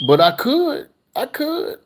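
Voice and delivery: high playful I could; teasing sing-song. Real, not acted. Vlogger teasing viewers he could cook live, high and sing-song. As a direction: tease them with the idea.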